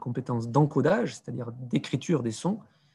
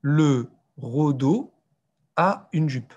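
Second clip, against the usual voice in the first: slow, halting beginner reader; imitated pupil, laboured reading; pupil reading aloud; 4 dB louder, much slower than usual. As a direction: sound it out word by word like a child learning to read. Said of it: "je leur demande de me lire la phrase".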